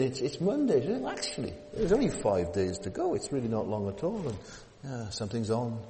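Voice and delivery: ordinary talk, nothing marked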